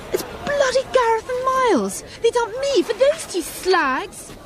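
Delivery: high, drawn-out indignation; outraged. Acted. Jilted woman spotting her exes marrying, outraged. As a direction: spit out the betrayal slowly, pitch up.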